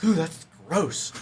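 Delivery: slow, high, loud mock disgust; imitating someone grossed out; disgusted nurse. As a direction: draw out the ooh in revulsion. Nurse disgusted by screeching bone.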